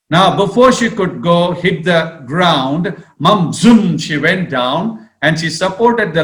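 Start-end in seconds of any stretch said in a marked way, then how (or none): none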